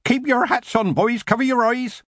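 high-pitched warning yell. A narrator's reading. Mister Sideways warns the boys, yelling. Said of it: "as Mister Sideways yelled".

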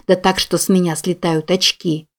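ordinary delivery, nothing marked

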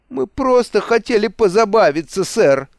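high, plaintive excuse; tearful pleading. A narrator's reading. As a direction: whimper an excuse to your captor. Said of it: "Зарёванный капитан Крюк повалился на колени". (плаксиво оправдываясь)

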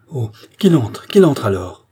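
loud, exclaimed order; impatient command. A narrator's reading. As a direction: urge it out forcefully. Impatient queen orders doctor in.

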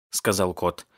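plain reading voice

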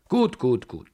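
stern grandmother overruling him; energetic and grim. A narrator's reading. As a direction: cut him off, no arguing. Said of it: "so energisch und grimmig".

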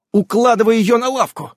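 high, urgent order; commanding. A narrator's reading. Irene directs helpers around injured Ulyanka, commanding. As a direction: keep giving orders briskly. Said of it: "продолжала командовать Ирен".

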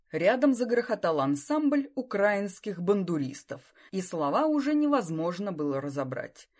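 plain reading voice

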